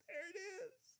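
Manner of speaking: tiny, very high, soft voice; hushed high-pitched exclamation; delighted squeal. Real, not acted. Preacher overcome as point lands.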